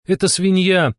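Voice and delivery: high-pitched, mildly exasperated reveal; exasperated sigh. A narrator's reading. Steklova revealing the answer as time ends, exasperated. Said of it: "вздохнула Стеклова".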